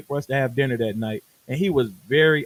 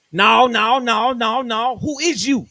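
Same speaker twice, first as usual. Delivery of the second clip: loud, higher mock yelling; shouting rapper; 7 semitones higher, 7 dB louder than usual. Rapper refusing the engineer's answer: a loud mock yell. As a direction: shout him down.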